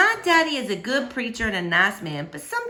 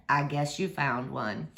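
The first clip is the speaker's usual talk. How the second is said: lower, softer, slow and kind; soft and gentle; 7 semitones lower, 6 dB quieter than usual. A narrator's reading. Preacher softly telling the stray dog it has a home. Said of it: "real soft".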